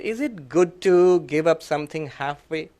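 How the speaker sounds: ordinary talk, nothing marked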